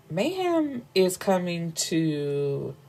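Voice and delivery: usual speaking voice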